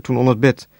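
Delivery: ordinary delivery, nothing marked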